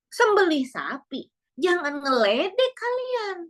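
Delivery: slow, high scoffing retort; a slow, high-pitched, offended protest; indignant disbelief. Retold or imitated. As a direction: scoff like you're being mocked. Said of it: "kata Yahudi".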